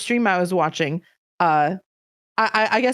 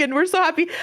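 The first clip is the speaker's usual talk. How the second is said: ordinary talk, nothing marked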